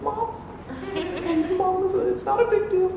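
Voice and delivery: put-on high, soft little-kid voice; timid, stumbling kid pleading up high; eleven-year-old. Boy waking his mom to confess, imitated sheepish child.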